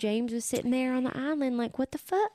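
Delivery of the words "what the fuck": shocked. Retold or imitated. James reacting on the island.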